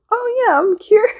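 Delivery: high, loud, hammed-up relief; mock instant happiness. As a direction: chirp it like a sudden fake cure. Mocks instant cure from depression.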